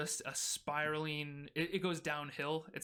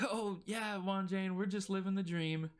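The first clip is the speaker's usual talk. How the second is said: higher-pitched, playfully sarcastic reply; friendly sarcasm; 5 semitones higher, 3 dB louder than usual. Acted. Guards answering the wagon driver's howdy, friendly but sarcastic. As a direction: rib him amiably with mock enthusiasm. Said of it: "respond in a friendly but sarcastic manner".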